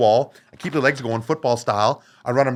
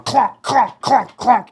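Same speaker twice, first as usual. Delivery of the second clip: high, loud mock clonks; taunting bouncer with mock clonk noises; clonking sounds; 12 semitones higher, 7 dB louder, much slower than usual. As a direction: bang out each clonk playfully. Mimicking skull knocks to bouncer.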